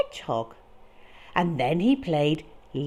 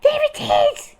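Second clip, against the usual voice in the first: high, loud scared shout; frightened cry; 9 dB louder than usual. Acted. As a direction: point and yell in fear. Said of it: "cried Frog".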